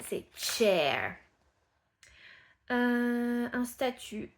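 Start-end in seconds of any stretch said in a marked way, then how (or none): 0.3-1.2 "chair" hard TCH, low falling. Shown as a demonstration. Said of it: "Alors que la chaise".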